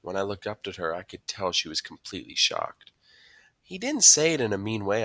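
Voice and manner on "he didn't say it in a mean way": ordinary delivery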